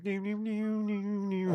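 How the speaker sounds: high-pitched little ding-ding cymbal noises; imitated finger cymbals. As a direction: ring like tiny cymbals, up high.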